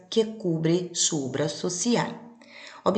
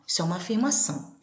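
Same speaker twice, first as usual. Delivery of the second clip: normal talking voice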